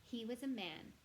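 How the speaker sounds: plain reading voice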